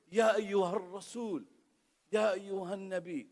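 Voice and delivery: ordinary delivery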